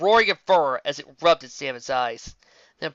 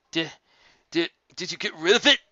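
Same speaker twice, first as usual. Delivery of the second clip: stammering colt; 3 semitones higher than usual. A narrator's reading.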